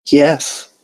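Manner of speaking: gentle yes from a timid filly; Fluttershy voice. A narrator's reading. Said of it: "Fluttershy said".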